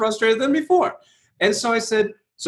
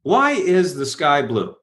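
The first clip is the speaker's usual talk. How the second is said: slow question; slower than usual. Shown as a demonstration. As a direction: ask it slowly and deliberately. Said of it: "say to you".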